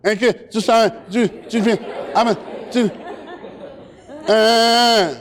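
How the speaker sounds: broken syllables between hitched breaths; mock trying to answer while crying; sobbing stutter. Shown as a demonstration. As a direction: choke on each word, ending in a wail. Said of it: "this is the way you sound".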